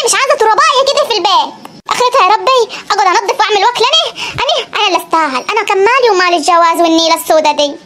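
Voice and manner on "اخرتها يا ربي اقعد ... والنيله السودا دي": put-on lower, grumbling old woman; a weary granny lamenting her marriage; old grandmother. Grandmother complaining to herself, lower and grumbling.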